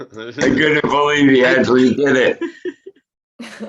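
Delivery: high, laughing disbelief. Real, not acted. Laughs recalling the outburst.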